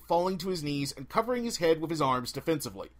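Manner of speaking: ordinary delivery, nothing marked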